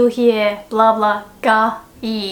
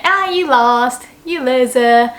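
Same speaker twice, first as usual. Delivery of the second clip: teasing; 2 semitones higher, 5 dB louder than usual. Shown as a demonstration. Taunting a friend who lost.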